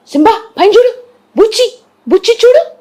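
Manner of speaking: high-pitched pet-calling voice; high, playful calls to a cat; coaxing a pet. Real, not acted. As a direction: call the cat's attention in a high sing-out.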